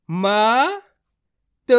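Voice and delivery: मत as two separate, held sounds, म then त; letter by letter. Shown as a demonstration. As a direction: sound out मत one letter at a time with a gap. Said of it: "एक अक्षर का उच्चारण करके".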